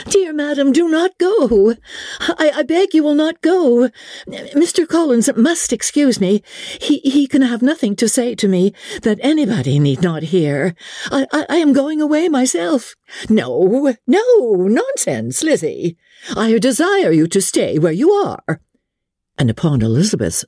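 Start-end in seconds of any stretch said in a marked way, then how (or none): 0.1-13.0 high, stammering panicked plea; pleading in alarm. A narrator's reading. Elizabeth begging her mother to stay, high and flustered. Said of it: "when Elizabeth called out". 13.3-18.6 high, overriding mother's voice; insistent Mrs Bennet. A narrator's reading. Mother ordering Lizzie to stay, high and firm. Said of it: "she added".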